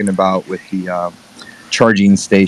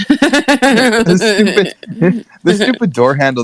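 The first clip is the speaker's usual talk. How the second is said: laughing; 10 semitones higher, much slower than usual. Real, not acted. Laughing while telling.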